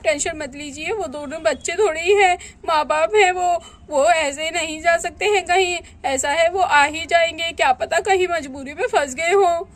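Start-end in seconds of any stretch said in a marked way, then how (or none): none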